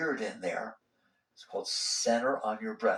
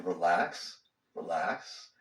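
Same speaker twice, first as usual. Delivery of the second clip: soothing; 8 semitones lower, much slower than usual. Shown as a demonstration. Soothing repetition of relax.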